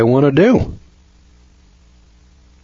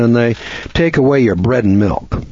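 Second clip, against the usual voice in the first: usual speaking voice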